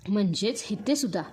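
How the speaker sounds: usual speaking voice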